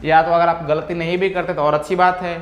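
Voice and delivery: usual speaking voice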